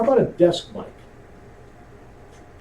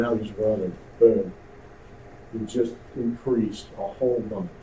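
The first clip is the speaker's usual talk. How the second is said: ordinary talk, nothing marked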